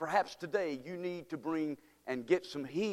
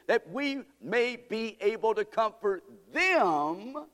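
fervent preaching; 6 semitones higher than usual. Acted. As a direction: press the point home. Preacher builds intensity.

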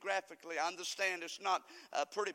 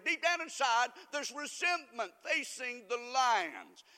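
preaching shout; 2 semitones higher, 4 dB louder than usual. Acted. Preacher crying out to the congregation about resentment. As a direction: build it up from the pulpit.

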